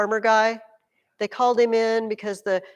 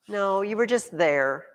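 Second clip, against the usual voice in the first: usual speaking voice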